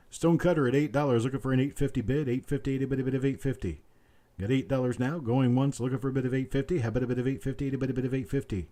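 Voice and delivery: rapid auctioneer patter, looping the price; auctioneer bid calling. Acted. Auctioneer calling for bids from stream viewers, quick rolling patter. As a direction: rattle off the bid call like a sale barn auctioneer.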